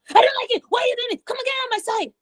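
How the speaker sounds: put-on high-pitched, indignant kid's protest; rude complaining tone. Shown as a demonstration. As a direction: whine back rapidly in a high, cross voice. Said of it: "Your tone of voice is important".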